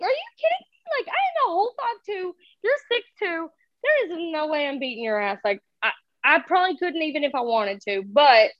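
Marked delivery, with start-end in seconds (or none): 0.0-5.4 high-pitched mock scream without the volume; retold screaming. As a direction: squeal it in disbelief, way up high. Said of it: "screaming in the background".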